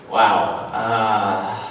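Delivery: low, drawn-out stunned wow; stunned reaction. Retold or imitated. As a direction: take it in slowly, voice dropped low, as a retold reaction. Acting out his stunned reaction.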